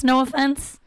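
a grudging "no offense" that means offense; insincere no offense. Shown as a demonstration. Comedian takes back her Trump-voter jab, a grudging no offense. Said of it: "You can't just say no offense when you actually mean offense".

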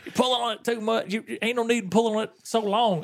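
high, indignant mock complaint; hot-tempered retelling. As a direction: imitate an exasperated man telling people off. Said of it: "Bernie was hot".

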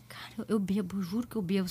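soft whispered begging; whispered plea. Retold or imitated. Pleading with the guard in a hushed whisper. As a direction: whisper it so nobody hears.

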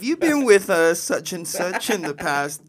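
high, slow, laughing caller impression; mock caller voice. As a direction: mimic a clinic caller through laughter. Mock phone caller's question.

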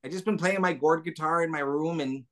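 high, gentle puppet voice, a little wistful; Gobo voice. Pretend. Gobo answering the hosts' question, high and a little wistful. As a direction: Gobo telling the hosts he misses everybody. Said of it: "I haven't done the voice".

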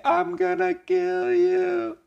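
very high, drawn-out spooky wail; eerie falsetto ghost moan. Acted. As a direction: wail it slowly like a haunting ghost. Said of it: "sale la voz de la fantasma Jenny".

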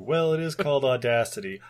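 ordinary delivery

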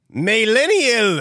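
slow, drawn-out Millennial in a strange accent; mock accent, slow; odd accent. Shown as a demonstration. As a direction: stretch Millennial out in a put-on accent. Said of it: "What accent is that?".

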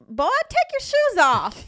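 loud, high, drawn-out Southern scolding; mock Southern order, slow and high-pitched; Southern accent. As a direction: holler it in a hammed-up Southern accent. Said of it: "like, a southern accent".

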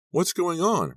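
high, loud call from a distance. A narrator's reading. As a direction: call over to the group. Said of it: "Ricky called".

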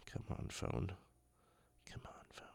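whispered plea to the phone; whispering. Real, not acted. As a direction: urge it under your breath. Urging the frozen phone.

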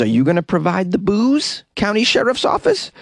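rising, teasing question to the sheriff; cheeky question. Real, not acted. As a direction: tease them with a high rise. Cheekily addressing the sheriff's office.

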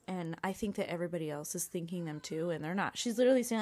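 normal talking voice

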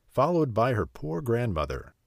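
plain reading voice

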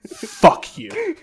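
high-pitched burst of outrage; high, indignant curse; exasperated outburst. Real, not acted. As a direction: let the composure snap upward. Rant breaks into outrage.

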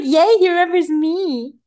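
high, loud gleeful cheer; mock delight. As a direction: imitate a thrilled squeal of recognition. Acting out Caroline's delight at recognition.

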